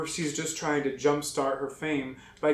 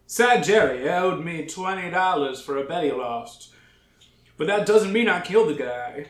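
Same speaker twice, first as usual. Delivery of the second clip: gangster voice; 2 semitones higher, 6 dB louder than usual. Acted.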